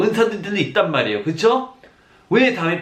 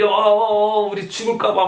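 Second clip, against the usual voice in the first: alarmed quote, pitched up; mock panic; 6 semitones higher than usual. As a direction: act out the frightened disciples. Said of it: "막 이러고 있어요".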